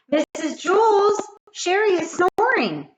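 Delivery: put-on high, loud little-girl call; tattling child. Kathy calling teacher to tattle on Sherry.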